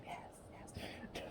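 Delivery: whispered yes. Retold or imitated. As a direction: breathe it out secretly. Whispered confirmation.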